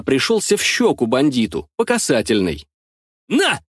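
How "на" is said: sharp high shout. A narrator's reading. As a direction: shout it out as you strike. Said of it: "выкрикиваю тогда я". (резкий выкрик)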